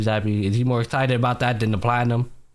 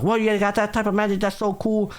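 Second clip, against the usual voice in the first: high-pitched impressed hype; gassing her up; 9 semitones higher than usual. Retold or imitated. Retelling him praising her magic, admiring hype. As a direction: imitate an admirer hyping someone up. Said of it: "he basically gasses up like".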